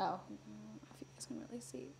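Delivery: whisper. Real, not acted. Whispered aside to viewers.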